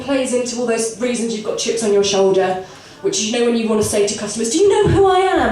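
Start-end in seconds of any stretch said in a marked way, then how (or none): 4.5-5.5 high, loud, self-important challenge; hammed-up indignation, pitched up; indignant. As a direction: demand it like an outraged somebody. Customer confrontation acted out.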